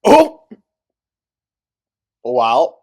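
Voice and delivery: shocked. Real, not acted. Stunned reaction to clip.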